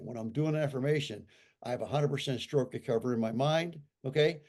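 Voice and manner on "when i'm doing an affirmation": normal talking voice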